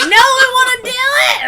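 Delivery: put-on high, loud childish whine; whiny shout. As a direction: wail it like a kid. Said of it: "'cause I was like".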